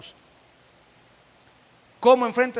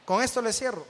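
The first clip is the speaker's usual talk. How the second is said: ordinary talk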